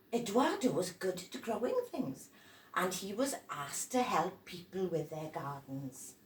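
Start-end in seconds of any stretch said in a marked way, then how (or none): none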